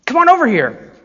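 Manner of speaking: high, loud call across the street; calling a friend over. Shown as a demonstration. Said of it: "seeing a friend, and you say".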